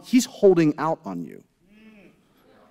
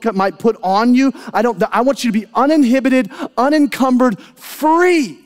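a proclamation swelling to free; building declaration; 3 semitones higher than usual. Retold or imitated. Preacher voicing God's promise of freedom, rising to a peak.

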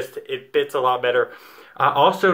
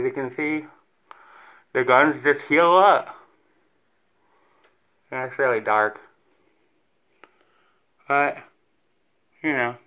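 fingers in mouth. Real, not acted. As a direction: mouth held wide open. Seen 3: Fingers inside lower lip, pulling it down to show gums while speaking.

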